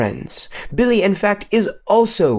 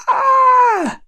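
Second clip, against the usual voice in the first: sudden high shriek, much higher and louder; goat scream; 4 dB louder than usual. Retold or imitated. Goats scream as Billy comes near, a high shriek. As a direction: shriek it out at full voice like a startled herd. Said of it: "Just like that".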